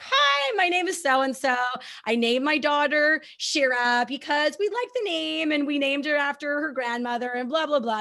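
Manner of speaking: high, bright mock-chirpy mom voice; chirpy other mom. Mom introducing herself at group, high and chirpy. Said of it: "and she's like".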